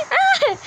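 short high-pitched "ah"; high startled exclamation; startled cry. Real, not acted. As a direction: yelp high in sudden dismay. Startled in the game.

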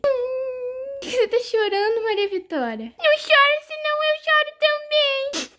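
put-on high tearful kid whimper; high weepy child voice; crying child. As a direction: whimper like a teary toddler. Dolls crying together.

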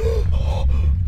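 high frightened gasp; sharp gasp. Real, not acted. Sudden fright.

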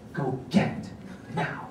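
stern whispered command, voice held down; quiet stern order. Retold or imitated. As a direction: order him under your breath. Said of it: "And I said it just like this".

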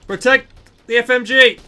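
high loud command; battle shout. Real, not acted. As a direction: yell the order to the squad.

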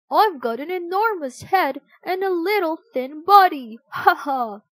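laughing through the line; chuckling while saying it. A narrator's reading. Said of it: "laughed Wilf".

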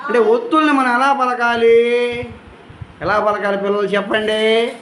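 ordinary talk, nothing marked

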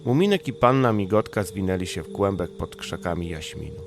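ordinary delivery, nothing marked

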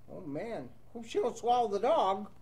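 ordinary delivery, nothing marked

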